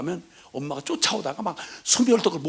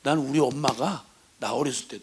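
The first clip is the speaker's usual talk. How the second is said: ordinary talk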